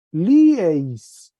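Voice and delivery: lieis said loud, falling, ending stressed; changed ending. Shown as a demonstration. Teacher reading the table, pointing out the ending, loud. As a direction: model lieis loudly to show the new ending. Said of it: "mire cómo cambió la desinencia".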